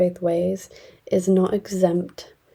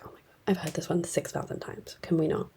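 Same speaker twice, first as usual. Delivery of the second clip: softer, whispered groan of boredom; 9 dB quieter than usual. Retold or imitated. Enrique tired of hearing the same story, bored whisper. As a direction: breathe it out, fed up with the story.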